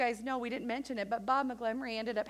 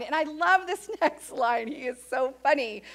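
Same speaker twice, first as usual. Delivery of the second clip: normal talking voice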